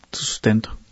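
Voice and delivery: drops to a whisper on su sustento; whispered aside. Real, not acted. Preacher hushing to a whisper over the widow's gift. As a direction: breathe su sustento out as a hushed secret.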